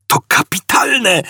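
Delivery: very high, excited cry; yelled. A narrator's reading. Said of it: "wrzasnął".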